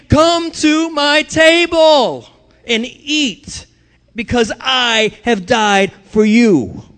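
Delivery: high, loud, slow call; God inviting. Acted. Preacher voicing God inviting people to his table, high, loud and slow. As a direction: call them warmly to the table, drawn out. God's invitation quoted in sermon.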